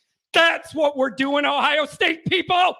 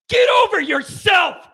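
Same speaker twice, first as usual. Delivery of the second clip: higher and much louder, dismissive; angry shout; 6 semitones higher, 8 dB louder than usual. Real, not acted. Radio host yelling at Ohio State fans to get over themselves. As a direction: bark it at them, fed up.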